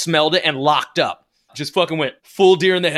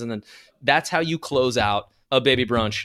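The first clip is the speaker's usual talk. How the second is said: usual speaking voice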